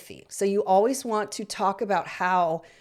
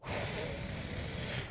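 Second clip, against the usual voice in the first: long breathy blow out; unvoiced calming exhale; slow demo exhale; 15 dB quieter than usual. Shown as a demonstration. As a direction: blow out gently as if cooling ice cream. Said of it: "take three deep breaths together like this".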